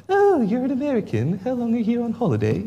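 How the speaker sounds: high, put-on English voice, puzzled; English locals' voice. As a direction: mimic an English local asking. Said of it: "they always go".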